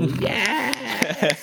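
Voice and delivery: high squealed yes; gleeful yes. Retold or imitated. Podcaster voicing the inner drama queen, a high gleeful squeal. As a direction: squeal it like a delighted drama fan. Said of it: "die Dramaschlampe in mir, die war so".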